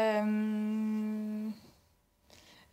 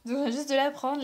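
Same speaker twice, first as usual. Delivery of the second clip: ordinary talk, nothing marked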